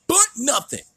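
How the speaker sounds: high loud mock retort; Wardlow impression. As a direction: snap it back, hammed-up. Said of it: "Wardlow then goes".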